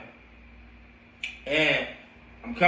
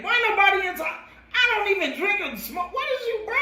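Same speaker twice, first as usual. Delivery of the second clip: very high, laughing disbelief; incredulous outrage. Retold or imitated. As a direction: squeal it out in disbelief. Outraged at police accusation.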